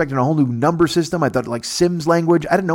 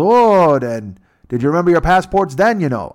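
mock Canadian agent; 5 semitones higher, 5 dB louder than usual. Border agent voiced.

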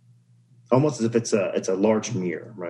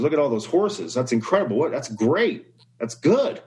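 neighbor voiced higher, gushing over the horses; rising higher and higher with delight; excited neighbor; 5 semitones higher than usual. Neighbor marveling at the thirteen horses, excited and rising. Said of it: "The neighbor goes".

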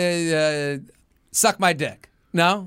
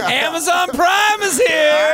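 high, loud, slow announcement; hammed-up high-pitched Amazon shout; mock delivery shout; 11 semitones higher, 5 dB louder, slower than usual. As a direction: bellow it like a silly courier.

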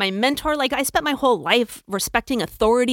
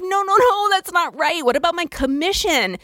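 indignant; 8 semitones higher than usual. Retold or imitated. Arguing with boss.

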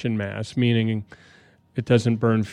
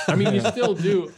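laughing; 8 semitones higher than usual. Real, not acted. Laughs teasing about selling ideas.

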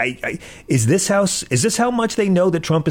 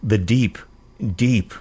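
pious voice; 6 semitones lower than usual. Retold or imitated. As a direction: imitate a self-righteous politician. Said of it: "I'm using my pious voice".

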